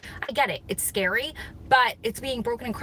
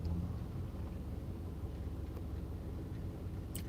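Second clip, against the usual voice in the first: laugh; 15 dB quieter than usual. Real, not acted. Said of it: "you're making me laugh".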